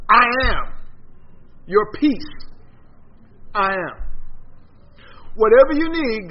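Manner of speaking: ringing pulpit delivery; fervent preaching. Acted. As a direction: preach it out to the congregation. Preaching at full force.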